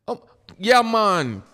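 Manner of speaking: put-on loud Jamaican accent. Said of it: "I do a better Jamaican".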